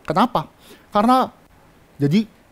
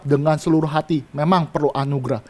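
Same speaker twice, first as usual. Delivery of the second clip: normal talking voice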